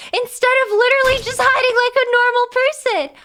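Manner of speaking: high, loud, laughing protest; exasperated laughing outburst. Real, not acted. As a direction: yell your complaint through the laughter. Laughing complaint at players running away.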